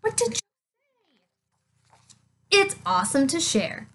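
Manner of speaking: usual speaking voice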